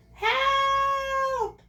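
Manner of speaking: high, loud drawn-out squeal for help; squealed cry. Acted. Drowning piglet crying out for help, squealed. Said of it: "he squealed".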